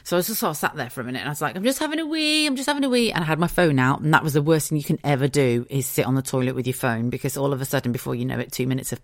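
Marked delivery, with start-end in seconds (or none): 1.6-3.1 high, innocent sing-song call; sing-song reply. Retold or imitated. Mum calling from the toilet, high sing-song. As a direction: chirp it out like nothing's wrong.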